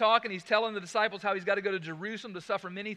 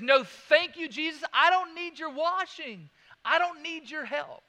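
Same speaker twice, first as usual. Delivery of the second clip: loud, higher mock refusal; proud refusal; 8 semitones higher, 9 dB louder than usual. Proud listener refusing Jesus's help, loud and high. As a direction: play the stubborn self-reliant voice.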